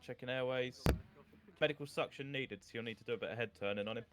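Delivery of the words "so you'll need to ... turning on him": ordinary talk, nothing marked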